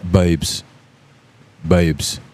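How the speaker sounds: babes in a low British accent. Shown as a demonstration. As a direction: say babes like a Jason Statham character. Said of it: "best word I know how to say in that accent".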